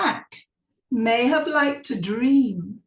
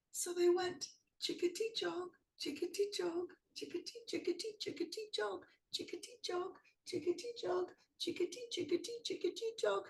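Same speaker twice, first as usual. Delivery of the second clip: much softer, hushed chant; quiet nervous chant; scared hush; 5 semitones higher, 12 dB quieter, faster than usual. A narrator's reading. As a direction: tiptoe through the dark forest. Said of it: "was a bit scared".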